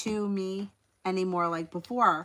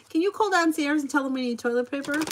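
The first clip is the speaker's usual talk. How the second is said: higher-pitched mock pleading question; imitated friend's request; 6 semitones higher than usual. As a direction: lightly mimic a friend asking a favour, pitched up. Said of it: "my friend would be like".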